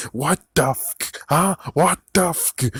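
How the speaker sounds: slow, high baffled what the fuck; mock disbelief. Viewer reacting to the photo, high mock bafflement.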